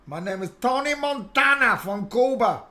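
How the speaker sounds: put-on Cuban accent. Trying a Cuban accent as Tony Montana. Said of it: "Cuban accent".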